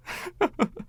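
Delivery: high, amused chuckle; a quick delighted chuckle. Acted. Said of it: "Freddie chuckled".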